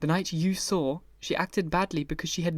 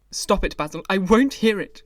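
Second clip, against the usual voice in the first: louder, higher protest; cried out; 4 semitones higher, 7 dB louder than usual. A narrator's reading. Dorian cutting off Basil, loud cry. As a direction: cry out to stop him. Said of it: "cried Dorian".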